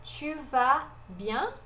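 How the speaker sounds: Tu vas bien, slow, word by word; Tu vas bien spaced out, puckered tu; silent S demo. Shown as a demonstration. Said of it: "a gente não pronuncia esse S".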